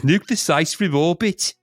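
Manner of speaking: slow, high comic Brummie; Brummie accent. Retold or imitated. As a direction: do the film line in drawn-out Brummie. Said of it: "with a Brummie accent where he says".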